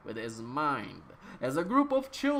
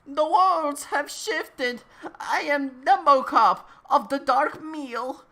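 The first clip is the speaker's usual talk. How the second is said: much higher and louder, slow, grand villain proclamation; booming cosmic villain; 11 semitones higher, 9 dB louder than usual. Acted. Dumbocop proclaiming himself to the ancient man, in a high booming villain voice. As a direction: declare it like a self-crowned dark lord.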